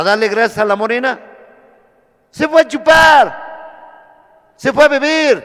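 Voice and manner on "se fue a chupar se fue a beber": loud, slow, high preaching shout. Real, not acted. A recovering alcoholic shouting his testimony at the meeting. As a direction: belt it out to the group.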